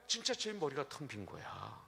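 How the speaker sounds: normal talking voice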